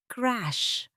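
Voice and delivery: usual speaking voice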